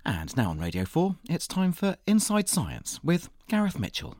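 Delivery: imitating a radio announcer, lifted pitch. Podcast host mimicking the announcer who introduces his show, announcer voice. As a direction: introduce the programme like a continuity announcer. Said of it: "an announcer said something like".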